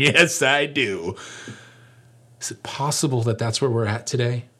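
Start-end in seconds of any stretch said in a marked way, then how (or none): 0.1-1.2 slow, dragged-out insistence; slow mock-pouty child reply; stubborn kid.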